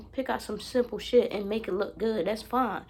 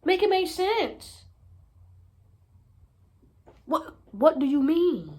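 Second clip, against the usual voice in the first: incredulous; 6 semitones higher, 5 dB louder than usual. Real, not acted. Incredulous, drawn-out questioning.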